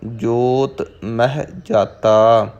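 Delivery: slow, drawn-out scripture reading with long line endings; Gurbani recitation. Shown as a demonstration. Teacher models the verse for learners, slow measured recitation. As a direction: recite it slowly, holding the final vowels.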